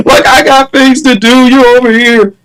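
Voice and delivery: high-pitched, put-out complaint; acted indignant plea; exasperated protest. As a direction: protest with the voice pushed up. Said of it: "I'm just like".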